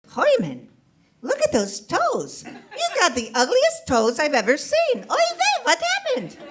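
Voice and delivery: put-on high, squawking Yiddish accent wife; a kvetching Yiddish bride.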